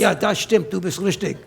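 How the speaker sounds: playful switch into German; German dialect. Pretend. Husband trying another dialect with wife, German accent. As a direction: answer in a mock German accent. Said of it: "just go to another dialect".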